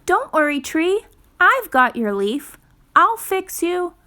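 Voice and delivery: put-on young forest animal comforting a tree; Fletcher's voice. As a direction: reassure the tree like a small eager creature.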